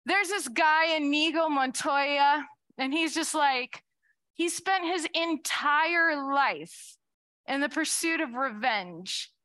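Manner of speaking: usual speaking voice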